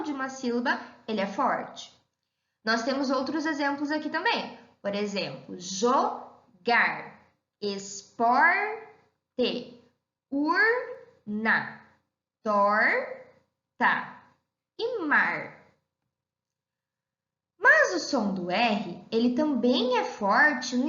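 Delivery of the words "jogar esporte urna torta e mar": "jogar, esporte, urna, torta", each word broken into syllables. Shown as a demonstration. Example words divided into syllables.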